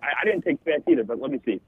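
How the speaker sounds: usual speaking voice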